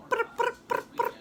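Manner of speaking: high rolled-lip purring; rolled purr imitation. Shown as a demonstration. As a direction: trill lips like a bird purr. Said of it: "You got a good purr".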